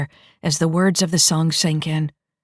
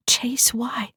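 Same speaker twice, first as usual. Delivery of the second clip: whisper; 7 semitones higher than usual. Acted. Private link question.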